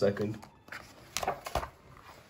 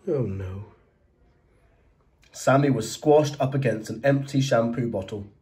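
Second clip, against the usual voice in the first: plain reading voice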